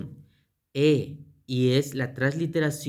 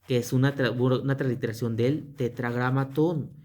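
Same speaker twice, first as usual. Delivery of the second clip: usual speaking voice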